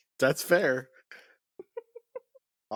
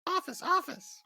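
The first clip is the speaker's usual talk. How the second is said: high mock echo; 4 dB quieter than usual. Mocking echo of office.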